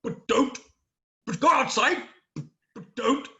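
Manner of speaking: loud, blustering contradictory orders; stop-start politician impression; Boris Johnson. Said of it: "Boris's hair".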